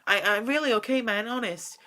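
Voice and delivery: put-on cheerful kid brushing off worry; boy insisting he's fine, only lightly voiced; lightly voiced young boy.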